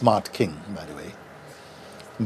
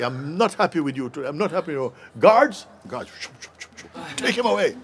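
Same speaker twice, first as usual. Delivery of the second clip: sulky angry king; 5 semitones higher, 4 dB quieter than usual. Retold or imitated. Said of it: "The king flew into a rage".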